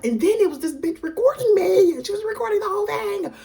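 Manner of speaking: very high, loud exasperated squealing; overwhelmed outburst. Real, not acted. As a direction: wail it as if completely overstimulated. Worked-up recap of being recorded.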